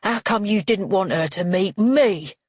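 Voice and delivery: loud, aggrieved demand in a rough voice; barked. A narrator's reading. Father left out by his son, barking. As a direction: bark it, building to the last word. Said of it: "he barks".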